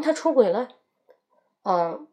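ordinary talk, nothing marked

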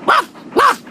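high, light woof woof barked out; high dog bark. Acted. Grandad Dog answering the parrot with a playful bark.

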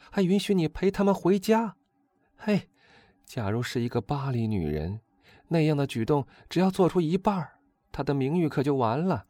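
put-on high-pitched, animated young gallant; excitable Albert. A narrator's reading. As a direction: grumble about women in a high, lively voice.

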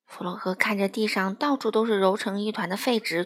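plain reading voice

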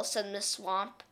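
plain reading voice

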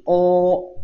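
"O", then "no" with lips rounded into an O; rounded O vowel. Shown as a demonstration. Teacher models the last kana, rounded demonstration of "O" and "no". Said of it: "呈O形".

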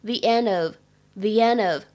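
slow "the end of" with end-of joined; "the end of" said as one linked chunk, slowly. Shown as a demonstration. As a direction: run "end" into "of": the-en-dof. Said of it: "end和of是可以连读的".

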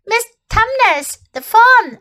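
Lucy naming her friend to Edmund; little girl. A narrator's reading. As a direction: answer proudly. Lucy voiced.

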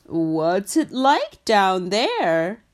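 slow, loud giraffe calling down to frog; a tall giraffe peering down. Acted. Giraffe asks frog now.

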